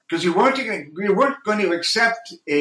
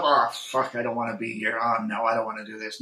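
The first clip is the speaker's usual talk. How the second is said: muttered reluctant inner voice; 6 semitones lower, 7 dB quieter than usual. Retold or imitated. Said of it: "never said to myself".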